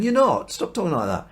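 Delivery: high, loud burst of mock indignation, then easing; exasperated protest. Peter refusing to hear Jesus talk of dying, exasperated. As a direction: cut him off in high-pitched disbelief.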